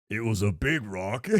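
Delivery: low, soft imitation of Killer Croc; a light take on the big dumb Croc line; Killer Croc quote. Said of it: "he's hilarious in the".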